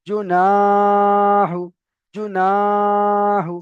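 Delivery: জুনাহু very slow and loud, each syllable held; drawn-out recitation. Shown as a demonstration. Teacher to students, slow chanted reading. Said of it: "খেয়াল করতে হবে".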